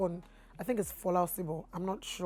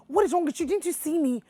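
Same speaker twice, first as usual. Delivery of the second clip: angry retort; 8 semitones higher, 4 dB louder than usual. Retold or imitated. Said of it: "And I'm like".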